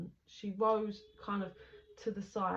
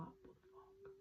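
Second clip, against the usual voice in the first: shocked; 14 semitones higher, 22 dB quieter, slower than usual. Retold or imitated. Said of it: "And he's like".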